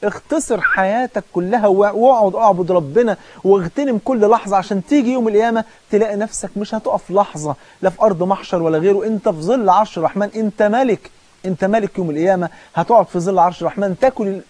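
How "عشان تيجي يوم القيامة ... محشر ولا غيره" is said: ordinary delivery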